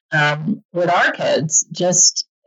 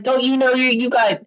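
higher, hammed-up lecturing parent; mock scolding parents; 7 semitones higher than usual. Parents scolding a complaining kid, mock exasperated and higher. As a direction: lecture the ungrateful kid in jest. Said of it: "They're like".

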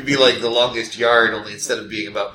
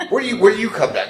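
ordinary talk, nothing marked

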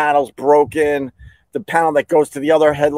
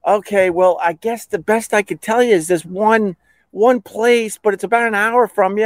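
pitched well up, apologetic clerk; imitated insurance rep; 9 semitones higher than usual. Insurance rep offering the one place left, higher voice. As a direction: voice a rep making a last offer, high.

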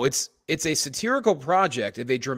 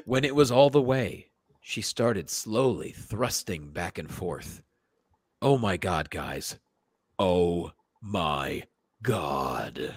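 dramatic reading; slower than usual. Acted. Dramatic reading with moaning finale.

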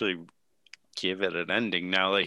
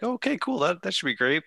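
upbeat; 8 semitones higher than usual. Retold or imitated. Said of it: "I'd be like".

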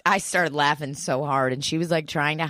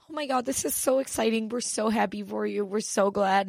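high, fast, softer mock excitement; imitating coworkers celebrating, high and breathless; gushing coworkers; 5 semitones higher, 4 dB quieter than usual. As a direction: squeal it like overjoyed coworkers. Coworkers react to clap news.

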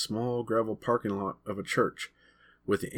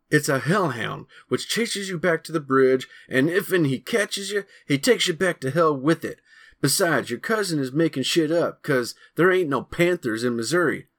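heated arguing; 6 semitones higher, 5 dB louder than usual. Acted. Boys arguing about the creature.

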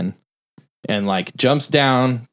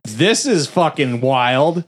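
high "oh", then slow wonder; retold amazement; 5 semitones higher, slower than usual. Recalling a wild film scene, amazed reaction. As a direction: relive being stunned, drawing the words out.

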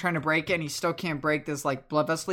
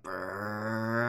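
low, sustained rolling brrr; imitated spinning whir; 7 semitones lower, 5 dB quieter than usual. Game master mimics Timber spinning the log fast, low whir. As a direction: roll out a low motor-like whir. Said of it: "spinning the big log around it really fast, and he's like".

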